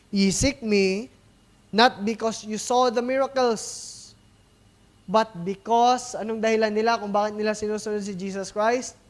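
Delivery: booming Bible reading from the pulpit; scripture reading. Acted. Reads scripture aloud.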